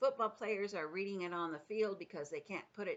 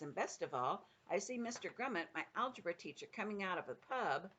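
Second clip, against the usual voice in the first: plain reading voice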